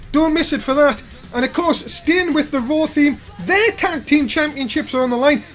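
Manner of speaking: normal talking voice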